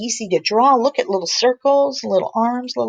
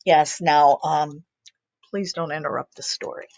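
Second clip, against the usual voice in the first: lower, dry dad voice; patient papa voice; 6 semitones lower than usual. Acted. Papa answering his chick in a low patient voice. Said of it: "kinda looks up and goes".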